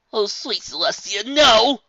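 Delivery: high, very loud cry of dismay; panicked young colt; horrified colt. Acted. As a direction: recoil at the ugly suit.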